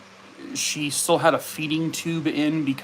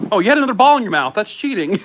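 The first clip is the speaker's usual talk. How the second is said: fast high loud mock outrage; playful tease; 7 semitones higher, 7 dB louder, faster than usual. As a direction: tease the dog for cheating. Playful teasing of dog.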